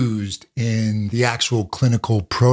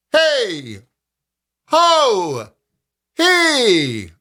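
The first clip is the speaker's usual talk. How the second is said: high projected call, not pressed; 10 semitones higher, much slower than usual. Shown as a demonstration. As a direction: call out across a parking lot. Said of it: "So you can use a calling voice".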